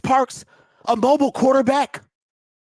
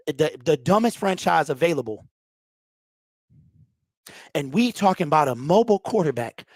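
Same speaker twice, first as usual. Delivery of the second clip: ordinary talk, nothing marked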